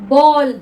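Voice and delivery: बॉल with the open aw vowel, said loud; ball with aw. Shown as a demonstration. Teacher answering her own question for students, loud. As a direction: model बॉल with a round open aw. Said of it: "क्या पढ़ते हैं हम इसको".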